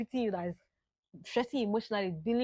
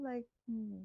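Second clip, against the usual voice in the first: normal talking voice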